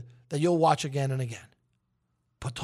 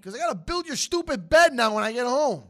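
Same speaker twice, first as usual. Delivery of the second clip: louder, higher mock-grumpy scolding; playfully gruff dad scolding; gruff impatient scolding; 7 semitones higher, 5 dB louder than usual. Mock scolding of his child.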